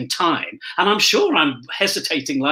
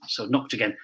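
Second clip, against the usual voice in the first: ordinary talk, nothing marked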